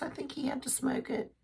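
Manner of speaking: a quick unvoiced aside, half to herself; whispered aside. Real, not acted. Grandmother whispering an aside about the smoked ham. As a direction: drop to a whisper mid-sentence. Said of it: "whispered aside".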